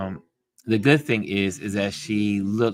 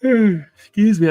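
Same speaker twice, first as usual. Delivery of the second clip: words stretched through a big yawn; a real yawn swallowing the words; 11 semitones higher, 8 dB louder, slower than usual. Real, not acted. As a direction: say it mid-yawn, high and loud. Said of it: "I'm so sorry for yawning on ya".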